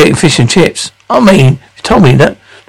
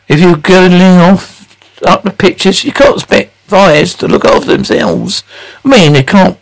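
ordinary talk, nothing marked

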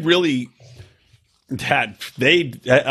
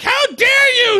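high, loud outraged cry; outraged shout; 4 dB louder, slower than usual. Retold or imitated. Retold woman scolding husband.

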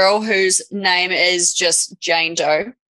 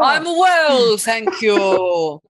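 commentator voice; much slower than usual. Acted. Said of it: "your commentator voice, [name], is clearly the worst one".

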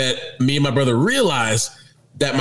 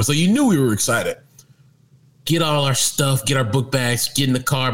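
normal talking voice

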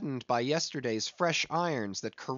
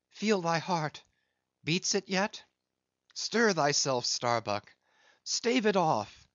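urgent, higher and rising; rousing himself; 4 semitones higher than usual. A narrator's reading. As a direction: shake yourself awake.

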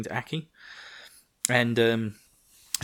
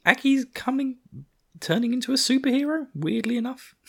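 much higher, giddy fan squeal; excited squeal. Real, not acted. Fan gushing to viewers about Aki, excited squeal. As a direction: gush like a fan whose hero just levelled up.

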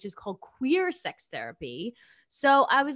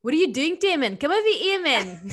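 put-on higher, scolding South African accent; mad South African mom; 5 semitones higher, 4 dB louder than usual. Mad mom calls her child over, imitated South African accent. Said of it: "when my mom's mad at me".

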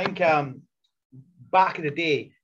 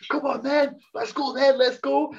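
fired-up brawl taunt, louder and higher; aggressive shout; 10 semitones higher, 4 dB louder, slower than usual. Retold or imitated. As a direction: pick a fight at full volume. Retold backstage brawl.

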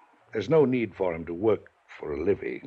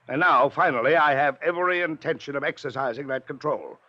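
raised, higher voice berating the nephew; heated scolding; 7 semitones higher, 3 dB louder than usual. Acted. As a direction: lay down the law, hot and hard. Uncle berates nephew over money.

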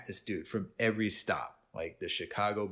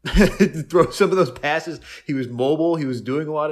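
louder, laughing through the words; 6 semitones higher, 10 dB louder than usual. Real, not acted. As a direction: laugh out loud at the surprise. Laughing in amazement at Flacco.

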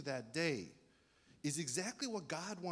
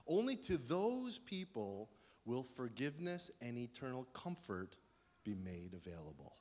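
ordinary talk

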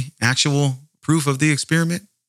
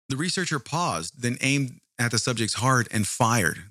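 ordinary delivery

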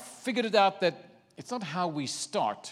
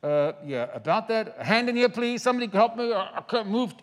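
comic helpless plea, pitched up; hesitant start, then higher, mock-desperate appeal; bound man pleading; 5 semitones higher, 3 dB louder than usual. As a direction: call for help while stuck. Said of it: "I could see him going".

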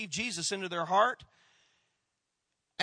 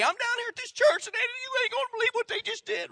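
much higher, bubbling with excitement on the phone; excited mom on phone; 3 dB louder than usual. Retold or imitated. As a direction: play the thrilled mom calling the family. Mom calling everybody on her phone.

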